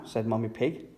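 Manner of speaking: usual speaking voice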